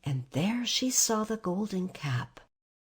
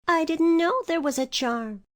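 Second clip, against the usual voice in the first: put-on high, louder girl voice; surprised Dorothy; 9 semitones higher, 4 dB louder than usual. A narrator's reading. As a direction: catch her off guard. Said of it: "in surprise".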